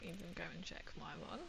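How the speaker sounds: unvoiced whispered aside. Real, not acted. Admitting to chat that she never checks, whispered. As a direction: whisper it like a confession.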